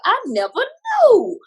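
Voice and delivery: slow, high, hammed-up comedian voice; Tony Baker. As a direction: draw it out high like a stand-up bit. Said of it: "I'm like Tony Baker".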